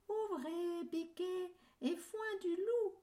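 high fake mother goat voice; wolf faking a sweet high voice; wolf imitating the goat. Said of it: "en imitant la voix de la chèvre".

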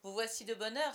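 jovial loud welcome; hearty exclamation. A narrator's reading. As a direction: exclaim it to a visitor. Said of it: "s'exclame Silver".

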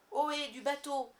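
loud, high pirate captain's hail; Crochet. A narrator's reading. Captain hailing from the lagoon, ringing shout. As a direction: call out so it rings across the lagoon. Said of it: "C'était la voix de Crochet".

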